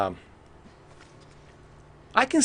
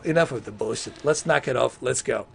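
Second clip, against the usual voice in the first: ordinary delivery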